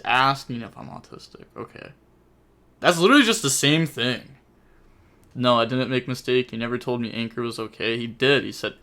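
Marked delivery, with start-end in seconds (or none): none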